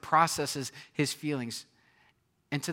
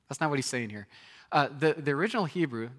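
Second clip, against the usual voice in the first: normal talking voice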